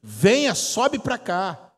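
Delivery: commanding call from heaven. Acted. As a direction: call out with authority, stretching the end. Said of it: "uma voz que dizia assim".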